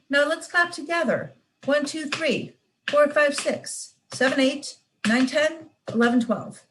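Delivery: high, careful counting along; eager child counting. Acted. Little girl clapping the rhythm with her father. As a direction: count like a proud little learner.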